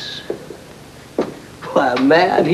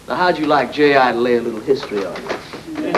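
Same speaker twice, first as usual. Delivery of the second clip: ordinary delivery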